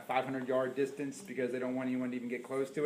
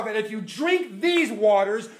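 much higher and slow, voice cracking with emotion; climactic preaching cry; 13 semitones higher, 6 dB louder, slower than usual. Real, not acted. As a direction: rise to an emotional peak on the promise. Sermon climax.

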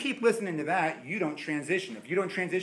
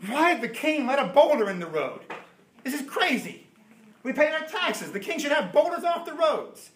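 higher, indignant grumbling; complaining rich folk; 9 semitones higher, 3 dB louder than usual. Acted. Wealthy travellers mocking the king over the boulder, indignant. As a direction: gripe about taxes.

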